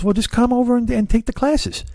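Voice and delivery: high-pitched imitation of a helpful neighbor; Lou impression. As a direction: push the voice way up, casually inviting. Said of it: "your ears are bleeding from my Lou".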